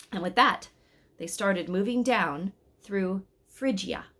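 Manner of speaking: ordinary delivery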